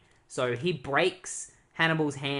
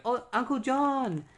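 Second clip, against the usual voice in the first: much higher, gushing relief; delighted niece calling out her uncle's name; joyful cry; 10 semitones higher than usual. Retold or imitated. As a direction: throw your arms round your uncle with your voice. Niece hugging her rescuer uncle.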